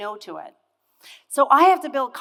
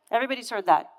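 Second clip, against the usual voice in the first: normal talking voice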